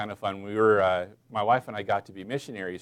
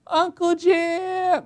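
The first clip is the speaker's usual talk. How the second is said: high, drawn-out mock scream; imitated child's scream; 5 dB louder, much slower than usual. Niece yelling to her uncle across church, high mock scream. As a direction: holler it high and long, like a little niece. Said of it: "screams at the top of her lungs".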